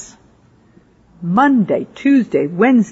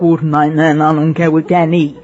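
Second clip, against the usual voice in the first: imitating unknown language; 3 semitones lower than usual. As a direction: lower run of foreign syllables. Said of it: "tried to write down things".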